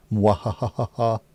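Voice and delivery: slow villain cackle, mock evil; hammed-up evil mwahaha; evil laugh. Devilishly good snacks, villain laugh.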